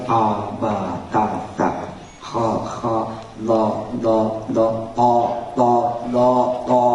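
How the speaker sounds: low, slow drilling of Arabic letters; reciting letter sounds. Shown as a demonstration. Preacher drilling Arabic letters, low and slow. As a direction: drill each letter like a beginner. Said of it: "Saya belajar lagi".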